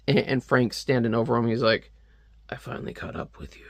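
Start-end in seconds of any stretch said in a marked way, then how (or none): 2.5-3.6 low, breathy hushed menace; menacing villain. Retold or imitated. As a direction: loom over him and threaten softly. Frank standing over Archie.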